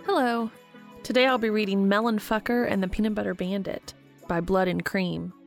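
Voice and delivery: ordinary delivery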